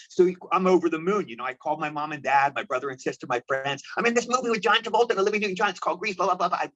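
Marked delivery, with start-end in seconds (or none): 4.0-6.6 fast, high-pitched gush of excitement; excited bragging. Retold or imitated. Retelling how he phoned his family to brag about getting cast, fast and excited. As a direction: rattle it off like you can't believe your luck. Telling family he got Grease.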